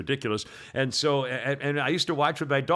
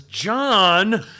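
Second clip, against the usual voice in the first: loud, higher, drawn-out name; scolding wife; 6 semitones higher, 9 dB louder than usual. Acted. Wife rebuking her meek husband, scolding. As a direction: call him out reproachfully.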